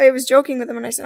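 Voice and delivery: normal talking voice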